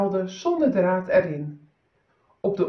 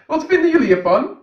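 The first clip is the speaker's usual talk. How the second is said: proud emperor asking; 8 semitones higher, 7 dB louder, faster than usual. Acted. Emperor asks his servants.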